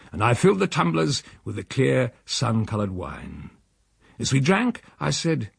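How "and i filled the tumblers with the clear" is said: ordinary delivery, nothing marked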